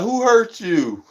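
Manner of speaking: slow, high teasing question; mock sympathy. Vlogger teasing an angry streamer with fake concern, slow. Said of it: "I be like".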